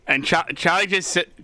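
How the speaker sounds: ordinary talk, nothing marked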